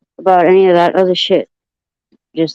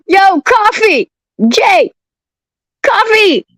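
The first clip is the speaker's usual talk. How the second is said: high, drawn-out yell of a name; 10 semitones higher than usual. Real, not acted. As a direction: call across the room to wake him. Yelling for friend.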